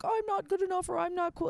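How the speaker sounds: high-pitched whine of self-doubt; mock self-pity. Imitating daters who blame themselves, mock self-pitying.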